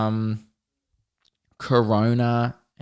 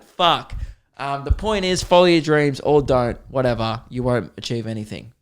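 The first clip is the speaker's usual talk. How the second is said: ordinary talk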